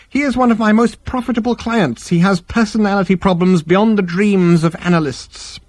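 high-pitched, smooth professional voice; musing psychiatrist. Acted. Psychiatrist discussing a profitable client, musing. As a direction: play the therapist weighing a thought.

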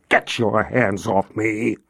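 slow, low, quiet menace; low menacing threat. Acted. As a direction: threaten him quietly, slowly. Knife under officer's chin.

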